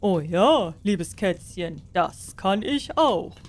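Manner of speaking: warm up to the cat, lightly flattered; friendly sorcerer. A narrator's reading. Flattered sorcerer answers the sweet-talking cat, slowly. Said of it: "ward ganz freundlich von den süßen Worten".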